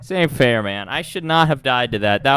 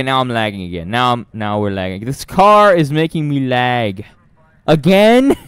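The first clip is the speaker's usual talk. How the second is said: ordinary talk, nothing marked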